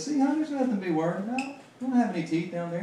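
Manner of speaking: high, fast coaxing "honey". Retold or imitated.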